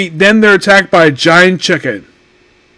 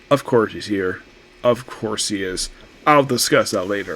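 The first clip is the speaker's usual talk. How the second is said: much lower and softer, a resigned knowing mutter; 8 semitones lower, 11 dB quieter than usual. Retold or imitated. Seeing a favorite actor in the cast, a low knowing mutter. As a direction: drop low and soft like an inside joke.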